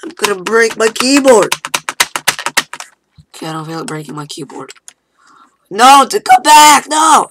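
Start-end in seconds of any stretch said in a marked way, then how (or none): 0.0-1.5 raging gamer. Real, not acted. Spamming the key in frustration. 5.7-7.3 panicked shout, louder and much higher. Real, not acted. Panicking at the game.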